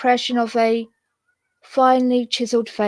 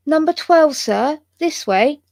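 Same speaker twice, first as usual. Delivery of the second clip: higher, lightly acted young maid; young woman's voice; 5 semitones higher than usual. A narrator's reading. Young maid showing the guest to his room, higher voice. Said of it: "a young woman's voice".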